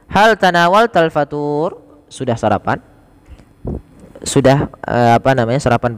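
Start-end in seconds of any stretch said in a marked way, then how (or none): 0.1-1.8 slow model question. Shown as a demonstration. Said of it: "Pertanyaan selanjutnya".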